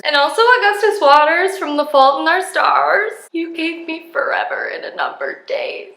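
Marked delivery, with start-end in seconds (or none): 3.3-5.9 breathy whispered movie line. Acted. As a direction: whisper it tenderly. Quoting Fault in Our Stars.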